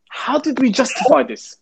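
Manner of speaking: high, incredulous inner question; disbelief. Retold or imitated. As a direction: ask it in stunned disbelief. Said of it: "I got back into the van thinking".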